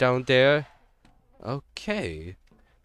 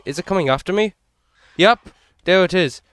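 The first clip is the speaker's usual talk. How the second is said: ordinary talk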